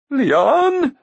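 very high, loud startled query; urgent giant's question. A narrator's reading. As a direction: pounce on the name. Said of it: "Glue quickly asked".

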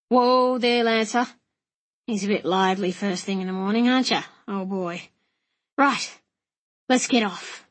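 rough-and-ready coachman, often quick; matey coachman. Acted. Coachman readying horses with young gentleman, casual and blunt. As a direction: play a blunt, chivvying bush coachman.

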